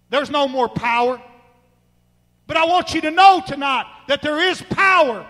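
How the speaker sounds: loud, high preacher's cry; fiery preaching. Real, not acted. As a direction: preach it out with full force. Preaching at full force.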